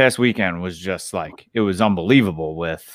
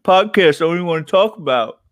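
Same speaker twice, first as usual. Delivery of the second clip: imitating whiny Aiden; 9 semitones higher than usual. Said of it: "He's like".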